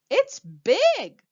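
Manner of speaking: an excited baker's loud cry; excited exclaim. Acted. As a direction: burst out with the big idea. Pete's big idea.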